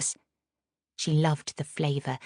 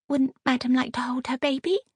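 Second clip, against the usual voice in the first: surprised nurse; 9 semitones higher than usual. Acted.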